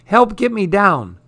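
put-on stuck kid yelling to be rescued; storybook yell. A narrator's reading. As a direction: holler from the treetops, half-acted.

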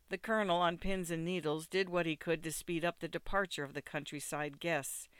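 plain reading voice

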